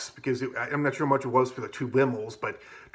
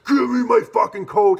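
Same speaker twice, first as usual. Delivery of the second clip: much higher, louder furious demand; retold angry yell; 10 semitones higher, 10 dB louder than usual. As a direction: bark it like a drunk grabbing a collar. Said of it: "He's like".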